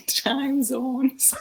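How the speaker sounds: Minnesota vowels, drawn out; Minnesotan accent. Shown as a demonstration. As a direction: say it like a Fargo local. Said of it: "say time zone with that accent".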